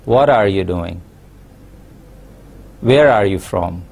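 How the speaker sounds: WH questions falling away to a low note on "doing" and "from". Shown as a demonstration. Teacher demonstrating WH questions, falling from "What" and "Where" to low endings. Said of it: "we have a falling tone".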